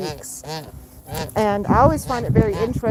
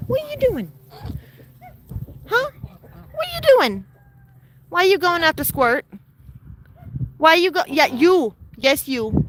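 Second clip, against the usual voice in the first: much higher playful telling-off; high pet scolding; 7 semitones higher than usual. Real, not acted. Owner asking the bird why it chases Squirt, in high playful scolding. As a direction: tease the naughty bird.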